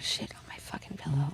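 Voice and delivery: hushed, bitter whisper; whispered complaint. Real, not acted. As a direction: whisper it in disgust. Bitter complaint about dog.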